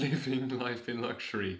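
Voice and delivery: ordinary delivery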